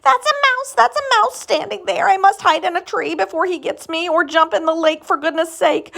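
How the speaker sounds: high, loud, frantic cries; a scared elephant yelling in fright; panicked elephant. Acted.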